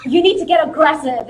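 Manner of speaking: urging coach. Retold or imitated. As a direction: imitate a coach pushing a fighter. Imitating coach urging her on.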